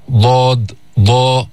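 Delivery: emphatic, full-mouthed ضا; ḍād demo. Shown as a demonstration. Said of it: "أقصى اللسان يرتفع".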